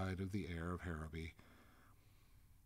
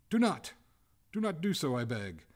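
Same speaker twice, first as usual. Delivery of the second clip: high, loud, alarmed plea; urgent begging cry; 8 semitones higher, 11 dB louder than usual. A narrator's reading. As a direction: cry out and beg them to stop. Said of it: "cried the ghost".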